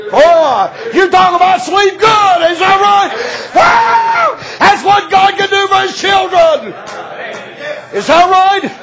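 high shouting praise with a whoop; preaching at full force. Acted. Preacher celebrating God's protection, shouting sermon. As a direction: shout it out in triumph.

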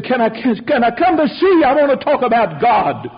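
high, loud pleading as a distraught caller; imitating tearful plea. Grieving father asking the pastor to meet, imitated high plea. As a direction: plead urgently, pitch leaping up. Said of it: "when he got through crying and trembling".

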